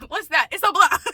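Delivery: real laughter at own joke; laughing. Real, not acted. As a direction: crack yourself up mid-line.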